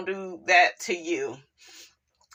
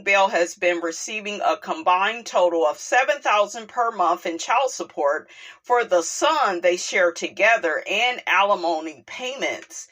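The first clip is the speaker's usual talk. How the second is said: normal talking voice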